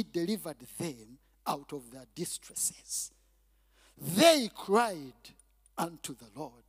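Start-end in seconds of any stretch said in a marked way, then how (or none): 4.0-6.6 "cried" hit loud, then a pause and a drop; emphasis on cried. Shown as a demonstration. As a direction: punch "cried", let "unto the Lord" fall away. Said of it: "I want to emphasize that".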